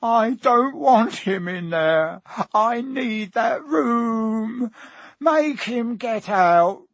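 high, drawn-out tantrum wail; loud, slow whining howl; bawling. A narrator's reading. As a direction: bawl like a spoilt child at his mother. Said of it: "Dudley bawling at his mother".

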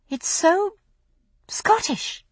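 high, sing-song mock wonder; amused high exclamation. Brianna teasing Roger about the fair, high and amused. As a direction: squeal it like a delighted tourist.

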